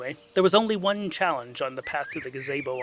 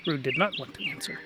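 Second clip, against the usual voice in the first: usual speaking voice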